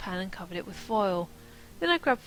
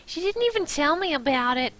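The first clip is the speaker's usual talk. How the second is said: high, loud indignant squeal; 6 semitones higher, 6 dB louder than usual. Acted. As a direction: carry the squeal through the complaint. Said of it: "I squealed".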